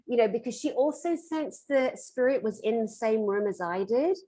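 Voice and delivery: ordinary delivery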